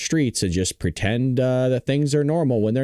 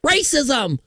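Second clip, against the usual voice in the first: high-pitched mock rallying cry; demonstrative shout. Shown as a demonstration. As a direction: shout it out like a slogan. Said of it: "I could shout stuff".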